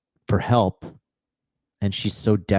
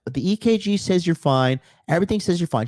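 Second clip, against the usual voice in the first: mouthy doctor; 8 semitones higher than usual. Retold or imitated. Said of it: "mouthing off to me".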